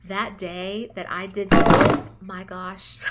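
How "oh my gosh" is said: loud, slow gushing exclamation; excited outburst. Real, not acted. Candle reviewer telling viewers about her favorite day, loud excited gush. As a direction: burst out with real delight. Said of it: "I'm getting so excited, I'm throwing things".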